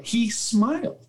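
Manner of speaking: high, drawn-out marvelling; real delight. Real, not acted. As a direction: marvel at it with rising pitch. Coach marvelling at the kid's smile.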